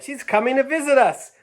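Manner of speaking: put-on a kid calling out happy news; shouted. Second child shouting that Grandma is coming, high. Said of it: "shouted the other".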